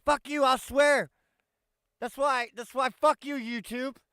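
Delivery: loud, high-pitched defiant swearing; defiant outburst. Real, not acted. Defying platform's swearing rule.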